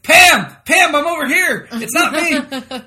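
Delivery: high, loud frantic yelling; panicked yell. Retold or imitated. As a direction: shout it, desperate to be heard. Voicing Dwight panicking.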